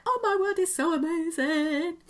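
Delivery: a lilting, sung-out squeal of excitement; gushing delight. Retold or imitated. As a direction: swoon over the guidebooks like a thrilled fan. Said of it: "and it's like".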